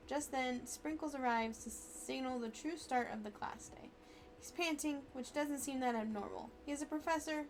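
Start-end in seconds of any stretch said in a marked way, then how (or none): none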